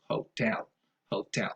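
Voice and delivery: hotel said ho-TEL, softly; soft model of hotel, beat on TEL; stress on second syllable. Shown as a demonstration. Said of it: "Stress goes on the second syllable".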